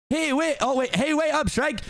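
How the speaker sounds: high, eager calling out; Donkey calling. Acted. Donkey running after Shrek, high calling voice. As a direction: chase after your friend.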